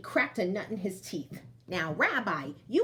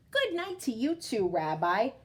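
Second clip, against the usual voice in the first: ordinary delivery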